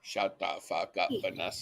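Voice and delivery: quiet muttered shut the fuck up; scoffing quietly at the woman on video; muttered aside. Real, not acted. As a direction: mutter it under your breath, dismissive.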